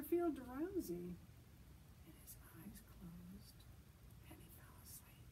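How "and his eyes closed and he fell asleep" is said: whisper. A narrator's reading. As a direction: breathe it out as he drifts off. Drifting off to sleep.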